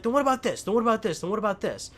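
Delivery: high, loud, insistent challenge; retold confrontation. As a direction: fire off each repeat like retold evidence in an argument. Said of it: "And I was like".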